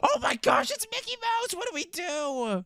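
gasping, very high squeal of alarm; mock panic. Player cornered by Mickey Mouse, high squealing panic.

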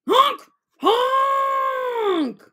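high, loud honking cry; loud honking shout. Shown as a demonstration. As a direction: honk it out like a goose. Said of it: "made a racket".

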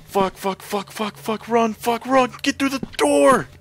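high, loud, breathless panic; panicked swearing. Real, not acted. Player running for the door, panicked yelling. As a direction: gasp out curses while running.

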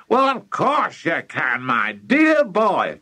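high, slow, hearty encouragement; excited cry. Acted. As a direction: stretch it out with grand confidence. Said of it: "cried Mister Cranky".

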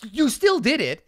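hammed-up tactless accusation; mock accusing. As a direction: needle them for breaking the rule. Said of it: "to be like".

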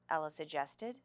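ordinary delivery, nothing marked

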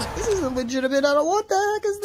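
high panicked cry. Acted. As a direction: freak out in alarm. Panicked victim.

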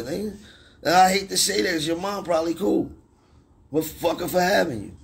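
heated, high-pitched yelling at a troll; real furious rant at a heckler; angry rant. Real, not acted. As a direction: tear into the troll, voice pushed up high.

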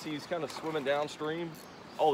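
ordinary talk

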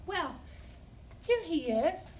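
ordinary delivery, nothing marked

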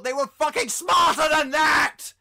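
high, loud climax of a rant; full-force indignant bellow; furious comic shout. Acted. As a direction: yell it in total exasperation.

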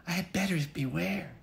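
a mild mock cry at hot porridge; little girl crying out. Goldie tasting hot porridge, high girlish mock cry.

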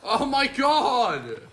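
high, loud, stretched-out exclamation; drawn-out outcry. Real, not acted. Player reacting to a blunder, loud drawn-out outcry. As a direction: wail it out slowly over a laugh.

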